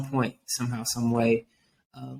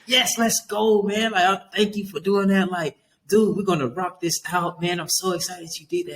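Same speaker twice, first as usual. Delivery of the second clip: high, fast, gushing praise; hyped-up cheering; 7 semitones higher than usual. Retold or imitated. Praising a student for finally starting his worksheet, high excited cheering. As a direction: lose your cool and celebrate. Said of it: "You gotta lose your cool card".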